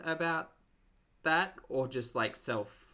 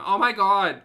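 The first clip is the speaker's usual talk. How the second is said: much louder, higher gasp of delight; excited; 7 semitones higher, 15 dB louder than usual. Real, not acted. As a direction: exclaim in nostalgic shock. Sees old house in clip.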